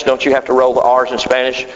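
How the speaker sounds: ordinary delivery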